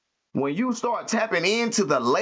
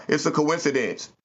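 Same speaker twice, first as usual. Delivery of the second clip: normal talking voice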